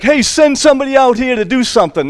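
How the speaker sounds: high, fast mocking call; brash challenger calling out; giant's taunt. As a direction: jeer across the valley. Goliath taunting the Israelites.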